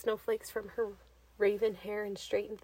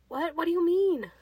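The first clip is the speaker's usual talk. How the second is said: much higher, louder startled cry; alarmed question; 8 semitones higher, 7 dB louder than usual. Acted. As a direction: panic breaking through.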